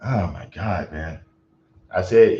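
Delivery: ordinary delivery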